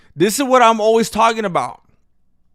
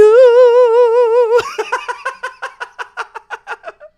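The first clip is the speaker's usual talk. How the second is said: high laugh; 8 dB louder than usual. Real, not acted. Laughing after song.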